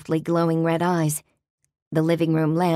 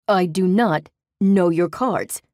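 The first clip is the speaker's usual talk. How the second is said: hint of a growl; 4 dB louder, slower than usual. A narrator's reading. Demon warning the narrator to drop the accusation, growling. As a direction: growl the words do not, slowly. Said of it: "At the hint of a growl in his voice".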